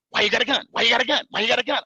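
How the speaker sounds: loud, high repeated demand; badgering questioning. Officers asking a cuffed man about his gun, badgering.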